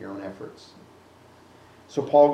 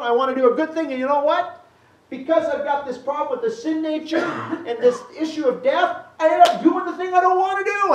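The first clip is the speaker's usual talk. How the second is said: Paul's voice climbing much higher, pleading and exasperated; frustrated Paul; 12 semitones higher, 4 dB louder than usual. Retold or imitated. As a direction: act out Paul stuck in his failing. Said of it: "Paul's frustrated".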